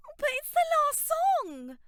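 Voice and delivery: high, loud pleading bleat. A narrator's reading. Dolores begging her friend to stay, a high loud bleat. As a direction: whine it out like a bleating sheep. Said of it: "bleated Dolores".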